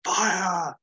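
high, drawn-out acted order; firing command. Major gives the firing command.

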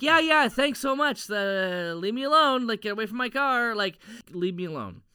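high, hurried get-rid-of-you imitation; hammed-up exasperation, settling down at the end; mock brush-off. Acts out brushing off a pestering man.

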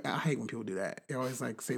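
ordinary delivery

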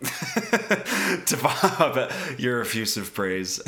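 chuckling through the words; slow, teasing laugh while speaking. Real, not acted. Teasing co-host after his rave.

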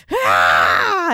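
superhero yell on the strike; Wonder Woman battle cry. Acted. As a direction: cry out as the whip comes down. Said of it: "doing my inner Wonder Woman".

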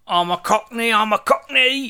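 high-pitched put-on Cockney accent; exaggerated Cockney. Mocking an actor's pretend accent, hammed-up Cockney. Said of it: "It sounded like".

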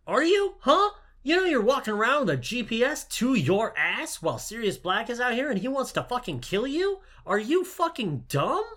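hammed-up yelling Lupin; angry rant. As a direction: scold him like an exasperated teacher yelling. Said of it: "He's like".

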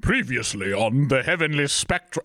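put-on TV recap announcer; odd recap voice. Host auditioning a previously-on voice, oddly put on. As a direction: try out an unidentifiable announcer character. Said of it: "I don't know what that is".

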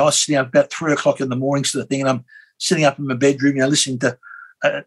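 ordinary delivery

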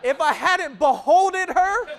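laughing, drawn out. Shown as a demonstration. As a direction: lean into the made-up word beholded with a laugh. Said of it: "I feel like George Bush making words up".

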